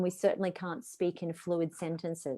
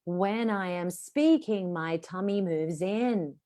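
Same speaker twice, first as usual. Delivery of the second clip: slow and smooth with the breath flowing; unhurried demo of airflow; slower than usual. Shown as a demonstration. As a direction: let each word ride the air. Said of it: "say it out loud and hear the airflow".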